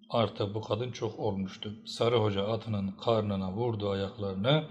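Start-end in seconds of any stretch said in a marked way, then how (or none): none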